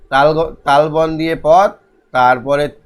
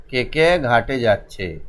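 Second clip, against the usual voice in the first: slower, lower repeat of the question; slow dictation; 4 semitones lower, slower than usual. Shown as a demonstration. Teacher repeating question one for the class, slow dictation. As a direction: draw it out for pupils to follow.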